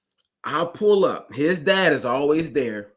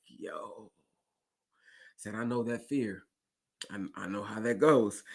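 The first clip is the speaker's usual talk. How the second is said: gentle comfort; 4 semitones lower, 9 dB quieter, slower than usual. Retold or imitated. Driver comforting a scared kid.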